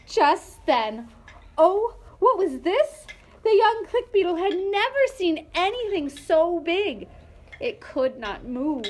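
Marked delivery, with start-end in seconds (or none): 0.1-7.1 louder, higher suspense; dramatic. A narrator's reading. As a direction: build the big reveal. Dramatic reveal of giant.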